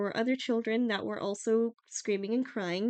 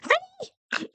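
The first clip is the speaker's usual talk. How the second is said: high, sweet mock troll mother calling her husband; a high hammed-up troll wife, sweet then shouting honey; Grýla voice; 11 semitones higher, 5 dB louder than usual. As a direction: play sugary Grýla, then call Honey! shrill and high. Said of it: "if Grýla's like".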